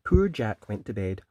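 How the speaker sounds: ordinary delivery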